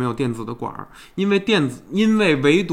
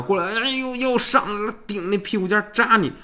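retelling cramp, pitched up high; pained high voice; 6 semitones higher than usual. Retold or imitated. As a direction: squeal of rising discomfort. Retold cramp returning. (肚子又疼起来的尖声)

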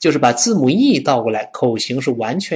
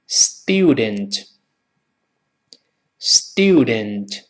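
student in slow British style, "styoo-dent"; British model of student with a y-glide; British pronunciation; 3 semitones lower, much slower than usual. Shown as a demonstration. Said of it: "英式发音". (英式发音慢读 student)

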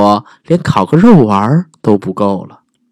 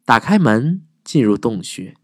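usual speaking voice

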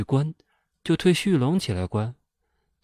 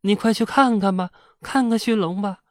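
panicked pleading; 9 semitones higher than usual. A narrator's reading. Wife urging in-laws in panic.

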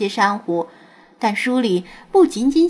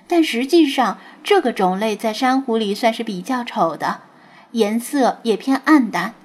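plain reading voice

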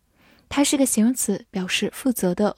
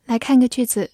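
usual speaking voice

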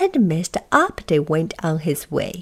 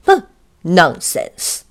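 dismissive, lower huff; rude; 3 semitones lower, 4 dB louder, slower than usual. A narrator's reading. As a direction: scoff it off rudely. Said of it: "said Mister Uppity rudely".

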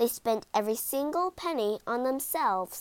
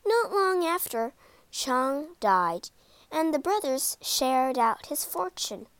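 usual speaking voice

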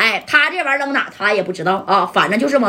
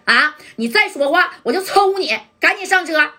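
angry threat; 6 semitones higher than usual. Acted. Ma San orders Jia Dai into the car, high angry threat. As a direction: lay down the law, hot-tempered.

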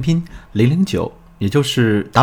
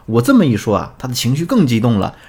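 ordinary delivery, nothing marked

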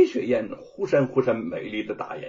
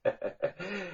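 soft, high, dainty laugh; light acted feminine giggle; coquettish giggle; 8 semitones higher, 10 dB quieter than usual. A narrator's reading. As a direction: titter coyly at your rival. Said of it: "白雪燕娇笑起来".

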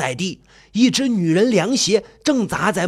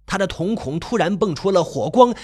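usual speaking voice

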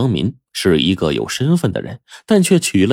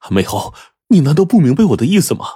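higher and louder, bursting out; excited; 6 semitones higher, 3 dB louder, faster than usual. Acted. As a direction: blurt out his feelings urgently. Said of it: "激动地说". (激动地脱口而出，声音更高更响)

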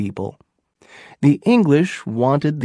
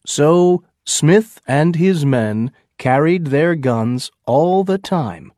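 ordinary delivery, nothing marked

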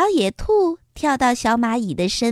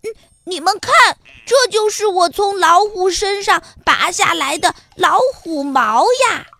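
louder, higher bragging announcement; loud boast; 5 semitones higher, 4 dB louder than usual. Acted. Ant holding the hair high to squirrel and rabbit, loud bragging. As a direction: show it off to everyone. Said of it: "大声说".

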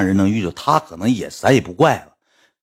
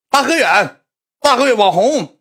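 high, loud fan calling out his name; excited fan calling; 13 semitones higher, 3 dB louder than usual. Retold or imitated. 粉丝在飞机上喊他. (兴奋粉丝高声大喊)

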